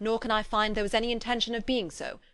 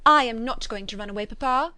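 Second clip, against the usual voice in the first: fretful, louder protest with a rising papa; 2 semitones higher, 7 dB louder than usual. A narrator's reading. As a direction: whine it back at papa, fretting. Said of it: "said Kitty fretfully".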